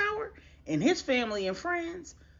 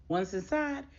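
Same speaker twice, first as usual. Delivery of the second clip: ordinary delivery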